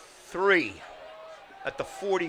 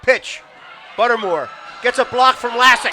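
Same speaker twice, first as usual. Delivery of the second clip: loud, rising play-by-play shout; excited call; 5 semitones higher, 8 dB louder than usual. Real, not acted. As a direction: call the big run as it breaks. Big run, live call.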